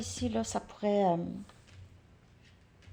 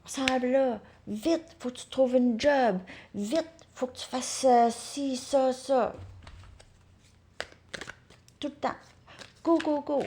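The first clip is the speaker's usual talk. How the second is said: higher-pitched mock nagging urgency; urgent pressuring; 5 semitones higher than usual. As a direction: imitate someone hounding you to hurry. Mimicking society's constant rushing pressure. (ton pressant, imité, plus aigu)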